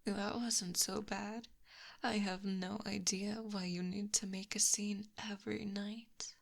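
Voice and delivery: usual speaking voice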